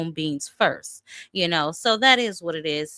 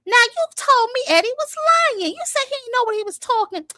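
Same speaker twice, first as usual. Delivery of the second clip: mocking scold; 5 dB louder than usual. Real, not acted. Addressing Shakur mockingly.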